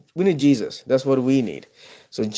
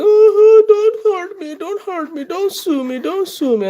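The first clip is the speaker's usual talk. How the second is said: high wailing falsetto plea; pleading victim; 4 dB louder, slower than usual. Retold or imitated. Voicing poor Russell begging not to be sued. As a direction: beg in a high crying falsetto. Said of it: "the poor Russell is going".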